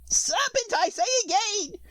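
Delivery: very high pigeon voice, insistent; pigeon repeating. A narrator's reading. Pigeon insists to Alice, very high. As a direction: peck the word out high. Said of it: "repeated the pigeon".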